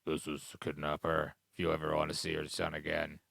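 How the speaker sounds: low, hushed disguised ransom-call voice; disguised kidnapper. Acted. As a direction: drop low and quiet like an anonymous kidnapper. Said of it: "a little thing on his voice".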